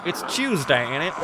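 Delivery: badly faked casual British; unproper British accent. Shown as a demonstration. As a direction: have a half-successful go at slangy British. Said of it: "your best unproper British".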